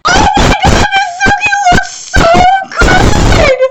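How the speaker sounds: high, loud squeal of delight; thrilled shout. Real, not acted. Fan seeing Misugi animated, ecstatic high shout. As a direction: yell it like your favourite just walked in.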